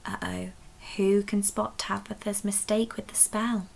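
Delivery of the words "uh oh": gasp. Acted. Spell goes wrong.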